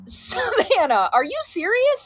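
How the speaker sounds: high, drawn-out name, then rising question; mock-scolding disbelief at your kid; incredulous. Mom retells reacting to faked illness.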